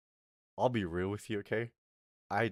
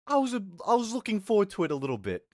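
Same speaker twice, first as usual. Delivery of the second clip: higher, louder, fast eager confession; excited; 11 semitones higher, 5 dB louder, faster than usual. Real, not acted. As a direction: rush it out, giddy. Admits he was eager.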